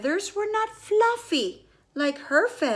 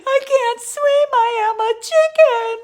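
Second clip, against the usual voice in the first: high, louder tearful wail; hen crying for babies; 8 semitones higher, 3 dB louder, faster than usual. Acted. Narrator as the mother hen calling for her lost babies, high and tearful. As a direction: wail it like a frantic little hen who lost her chicks. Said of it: "She's like".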